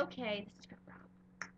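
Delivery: sneaky ninja whisper; hushed conspiratorial whisper. Retold or imitated. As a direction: whisper it like a secret agent. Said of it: "ninja being like".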